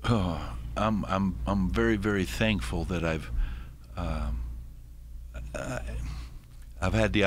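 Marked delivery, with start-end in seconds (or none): none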